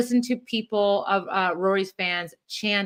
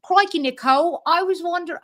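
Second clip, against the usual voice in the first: higher, hammed-up Aussie voice; attempted Australian accent; 8 semitones higher, 3 dB louder than usual. Host imitating an Australian husband talking to his wife, botched Australian accent. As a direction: do a bad Australian accent that slips toward Scottish. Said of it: "everyone sounds Scottish. I'm sorry, I can't do it".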